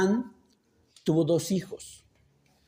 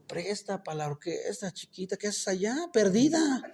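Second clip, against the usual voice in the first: flirty come-on; 5 dB quieter than usual. Acted.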